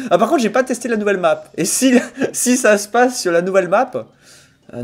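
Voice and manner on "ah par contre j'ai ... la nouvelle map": usual speaking voice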